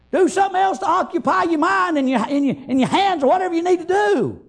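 urgent Southern revival preacher; fervent preaching. Real, not acted. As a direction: preach it hard, driving each phrase home. Preaches with force throughout.

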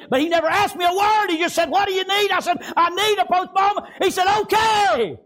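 high, loud revival preacher's shout; full-force preaching. Real, not acted. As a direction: preach it at the top of your voice, pitched high.

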